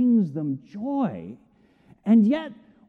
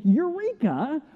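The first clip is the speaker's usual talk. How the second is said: mock eureka; 7 semitones higher, slower than usual. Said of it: "I don't think".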